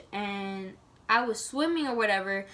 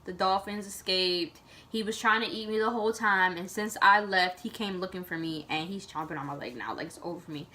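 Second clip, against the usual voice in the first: softer and lower, fading out; retelling her last thoughts before passing out, fading; resigned fading; 4 semitones lower, 3 dB quieter than usual. Retold or imitated. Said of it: "And I'm like".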